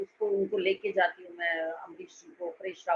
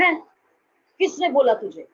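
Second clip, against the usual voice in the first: sharp, louder scolding demand; angry scolding; 4 semitones higher, 8 dB louder than usual. Retold or imitated. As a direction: snap at her, demanding who said so. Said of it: "इतनी जोर से डांटा मुझे". (गुस्से में डांटते हुए)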